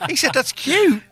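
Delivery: high mincing mimic of a sweet remark; mock-cutesy voice; mocking imitation. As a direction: send up his cute comment in a squeaky voice.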